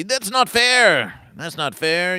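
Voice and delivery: high, loud, drawn-out complaint; mock whining protest. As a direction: whine it loudly like a sore loser. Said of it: "you may whine and complain".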